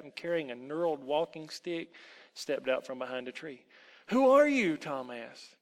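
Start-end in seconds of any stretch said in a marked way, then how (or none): 4.1-4.8 loud, high startled question; alarmed demand. Acted. Boy challenges the elf behind the tree, alarmed. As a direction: blurt it out in alarm. Frightened boy confronts the stranger.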